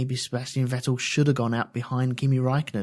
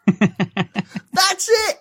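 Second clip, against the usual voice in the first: normal talking voice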